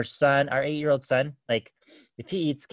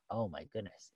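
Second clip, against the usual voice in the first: much softer, lower exasperated oh my goodness; quiet dismay; 5 semitones lower, 14 dB quieter than usual. Retold or imitated. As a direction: sigh it out under your breath. Kids screaming upstairs.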